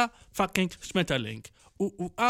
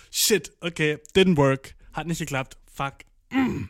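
ordinary talk

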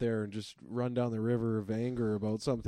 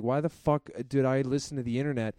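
ordinary delivery

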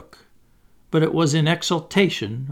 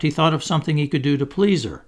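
ordinary delivery

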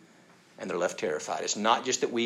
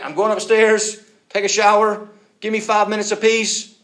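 raised voice, pitched high; stern parent; 11 semitones higher, 13 dB louder than usual. Acted. Harried parent tells the children the plan, loudly. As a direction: announce it loudly to the whole house.

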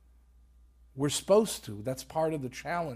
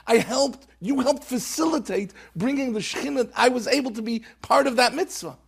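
fervent reassurance; 9 semitones higher, 9 dB louder than usual. Retold or imitated. Dead brother reassures in dream.

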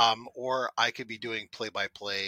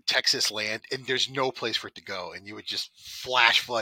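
ordinary talk

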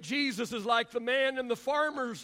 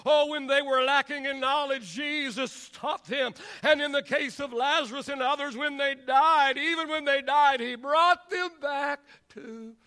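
impassioned preaching; 3 semitones higher, 5 dB louder than usual. Acted. Preacher recounts what Jesus did for people, building fervently. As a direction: punch each clause in driving rhythm.

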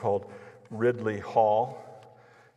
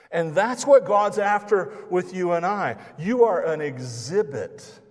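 climactic preaching; 6 semitones higher, 6 dB louder, slower than usual. Real, not acted.

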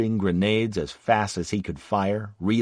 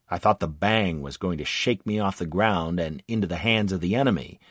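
plain reading voice

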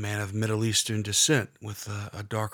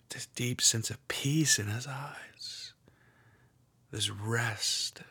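hushed guided meditation, half whispered; guided prayer meditation. Acted. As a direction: lead them gently into prayer.

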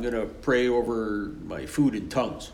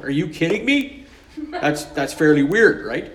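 incredulous; 4 semitones higher, 4 dB louder than usual. Retold or imitated. Woman's incredulous reaction.

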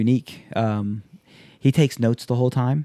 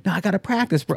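much higher, pleading friend; mock whiny Waz; 9 semitones higher, faster than usual. Imitating Waz insisting on practice, higher pleading. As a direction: whine it high like the friend. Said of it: "Waz's reply voiced".

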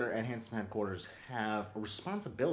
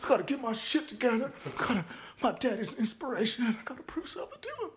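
put-on higher, earnest drunk revelation; higher, hurried promise to daddy; young Bush epiphany; 10 semitones higher than usual. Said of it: "He's like".

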